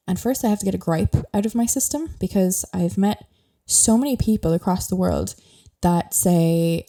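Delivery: ordinary talk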